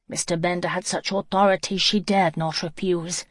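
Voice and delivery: ordinary delivery, nothing marked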